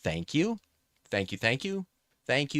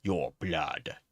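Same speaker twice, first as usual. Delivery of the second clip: badly faked British accent; crappy British accent; 4 semitones lower than usual. Shown as a demonstration. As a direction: show off a crummy British accent. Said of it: "a really crappy British accent".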